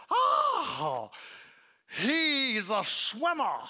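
high, loud, slow mock jab; teasing the skinny boy about his sport, sarcastic. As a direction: drawl it out sarcastically as a put-down.

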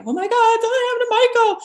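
high, loud, rushed cry of alarm; panicked yelling. Retold or imitated. Woman crying out to her sisters that Michael is gone, panicked yell. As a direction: blurt it out in sudden terror, fast. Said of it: "I started yelling that something had happened to Michael".

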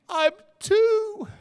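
put-on high, loud childish reply; little kid voice. Toddler answering the scolding parent, high and childish. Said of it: "And the little kid".

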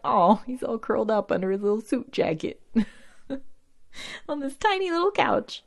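high cooing over something cute. Retold or imitated. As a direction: coo like at a puppy. Cooing over cute napping man.

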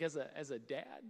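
ordinary talk

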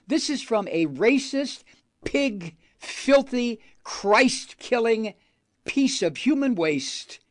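high, furious spitting of insults; angry rant. Real, not acted. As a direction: lash out at him with contempt. Ranting insults at pastor.

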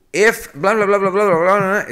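high, loud singsong blah-blah, brushing off the whole clause; dismissive blah blah. Pretend. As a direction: toss off the filler like it doesn't matter. Mock placeholder for German clause.

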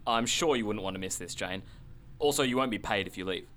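ordinary delivery

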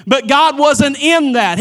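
loud, high, slow preaching climax; heated sermon peak, loud; preaching at full force. Real, not acted. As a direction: drive it home to the congregation.

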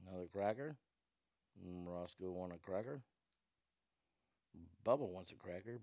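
soft, low coo; pet cooing. Real, not acted. Talking to pet Roscoe, quiet coaxing baby talk. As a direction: gently offer the treat.

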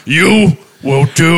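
slow, weighty patriarch's order; mock stern father. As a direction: lay down the law as the oat-farm dad. Plays stern oat-farming father.

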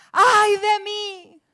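high, loud, slow drawn-out wail; anguished cry. Shown as a demonstration. Prophet crying out before God's throne, a loud slow wail. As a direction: cry it out in dread, stretching every word. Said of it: "Está ahí con exclamación".

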